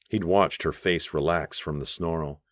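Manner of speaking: plain reading voice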